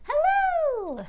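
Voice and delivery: squeaky high hello. Shown as a demonstration. Teacher shows the kids a high hello. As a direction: go as high as you can. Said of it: "Let's say hello as high as we can".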